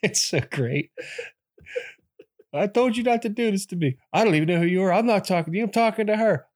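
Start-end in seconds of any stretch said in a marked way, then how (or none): 2.5-6.5 loud, high acted outburst with laughter creeping in; mock indignation. Man in a movie theater telling off a stranger, mock indignant. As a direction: imitate a flustered man snapping at a stranger.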